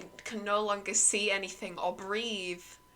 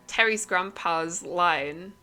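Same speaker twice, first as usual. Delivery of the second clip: plain reading voice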